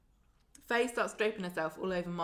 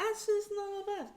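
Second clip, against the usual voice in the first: much higher put-on voice; mock high squeaky voice; 14 semitones higher, faster than usual. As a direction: squeak it out like a silly impression. Mimicking herself, very high.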